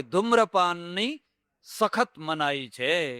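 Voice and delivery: slow, spaced-out reading of the sign; drawn-out public notice. Acted. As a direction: read out ધૂમ્રપાનની સખત મનાઈ છે word by word, very slowly. Reads bus sign slowly.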